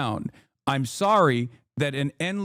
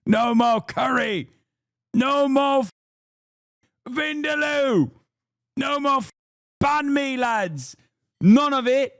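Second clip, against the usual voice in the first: hammed-up chant, much higher, slow and shouted; mock rally yell; 10 semitones higher than usual. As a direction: yell it like a parody of a protester. Mock rally yelling about food.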